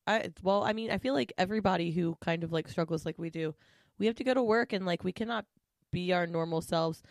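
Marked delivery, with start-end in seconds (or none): none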